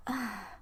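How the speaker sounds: soft acted sigh; weary sigh. Ben before answering Herb's suspicion, sighing. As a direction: let out a quiet sigh. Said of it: "Ben sighs like".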